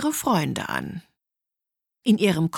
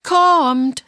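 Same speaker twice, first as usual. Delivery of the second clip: higher, louder called reply; calling out; 6 semitones higher, 7 dB louder than usual. A narrator's reading. Hen answers the pig's demand, calling out. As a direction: call it out to someone across the house. Hen calls back to pig.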